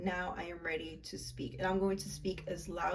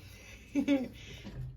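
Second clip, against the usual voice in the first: laugh; 7 semitones higher than usual. Real, not acted. Relieved after meditation.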